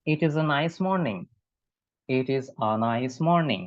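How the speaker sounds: slow, careful model reading; teacher modelling slowly; slow model sentence. Shown as a demonstration. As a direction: say it slowly for students to copy. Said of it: "এটা বলবেন".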